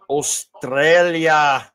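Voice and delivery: drawn-out, hammed-up Australian accent; bad Australian accent. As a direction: stretch it into a terrible Aussie accent. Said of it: "That's a horrible accent".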